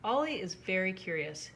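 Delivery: plain reading voice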